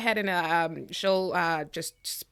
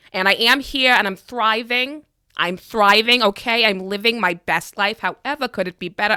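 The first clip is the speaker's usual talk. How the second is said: higher, louder pointed grievance; loud confrontation; 4 semitones higher, 6 dB louder than usual. Acted. Character loudly airing a grudge to Uncle Mikey's ghost. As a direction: air the grudge, pushing hard.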